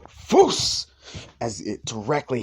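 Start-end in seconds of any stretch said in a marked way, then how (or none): 0.1-0.9 much higher, much louder battle cry; shout. Acted. Deku shouts at the vampire, much higher and louder. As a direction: blast the word out with everything. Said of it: "and then screams out".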